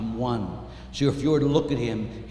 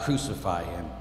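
low, soft, sorrowful echo of a cry; subdued and grave, falling away; hushed crowd cry; 9 semitones lower, 8 dB quieter than usual. Acted. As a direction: let the cry sink almost to nothing. Same cry repeated in hush.

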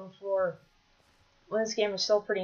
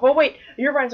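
loud, higher startled call; sudden alarm; 5 semitones higher, 11 dB louder than usual. Real, not acted. Player spotting Herobrine nearby, alarmed. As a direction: blurt it out, startled.

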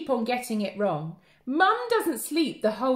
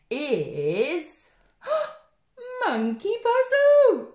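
dramatic gasp; 7 semitones higher, 6 dB louder, much slower than usual. Acted. Teacher thrilling toddlers with the book title. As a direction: gasp then slow, louder title reveal.

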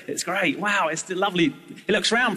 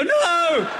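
horrified no; 12 semitones higher than usual. Retold or imitated. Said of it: "they go".

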